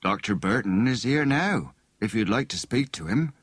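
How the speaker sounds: ordinary delivery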